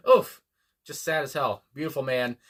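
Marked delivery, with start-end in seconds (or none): 0.1-0.4 sharp loud pained oof, jumping high; a loud wince of sorrow. Real, not acted. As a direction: let out a gut-punched oof. Moved by the singer's death.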